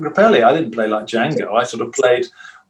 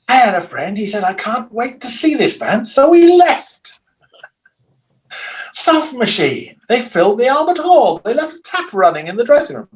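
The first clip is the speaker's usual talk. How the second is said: higher, slowed comic announcer voice; Spike Milligan; 9 semitones higher than usual. Retold or imitated. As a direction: deliver it like a deadpan comedian's intro. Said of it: "who went".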